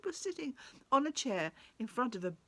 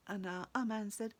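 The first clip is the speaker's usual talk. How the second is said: plain reading voice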